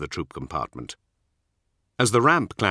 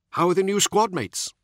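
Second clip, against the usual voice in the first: higher-pitched younger warrior voice; Nemiel; 12 semitones higher than usual. A narrator's reading. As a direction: lift the voice for the younger marine.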